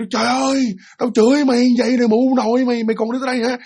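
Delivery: high-pitched acted cursing rant; noisy cursing. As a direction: scold and threaten in a high, agitated voice. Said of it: "chửi sùm hết trơn". (giọng cao, chửi sùm)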